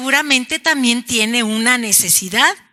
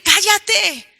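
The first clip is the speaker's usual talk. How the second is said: a hint of a scolding crowd, higher; hushing scold; 6 semitones higher, slower than usual. Crowd by the road hushing the beggar, mild scold. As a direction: shush him, lightly voiced. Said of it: "Trataron de decirle".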